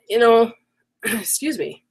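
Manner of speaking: normal talking voice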